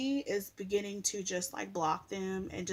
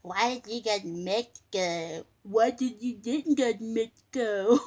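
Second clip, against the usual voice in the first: imitating nagging haters, louder; mocking haters; 6 dB louder than usual. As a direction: mimic the haters' whiny questions. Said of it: "They'll be".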